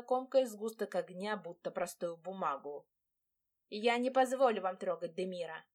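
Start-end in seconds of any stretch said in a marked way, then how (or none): none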